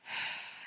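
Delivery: long relaxing breath sigh; audible deep breath. Shown as a demonstration. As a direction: breathe audibly to guide the listener. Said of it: "just take a big inhale".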